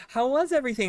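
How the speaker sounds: high, sugary-sweet server greeting; super nice. Retold or imitated. Server checking on the table after cursing in back, super nice. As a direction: lift it way up, friendly to the table. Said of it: "I was, like, super nice to them".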